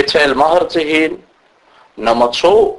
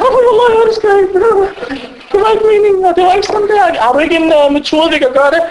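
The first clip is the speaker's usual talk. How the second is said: much higher whiny plea, hammed-up; mock pleading husband; 11 semitones higher than usual. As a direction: beg the sheikh for a way out.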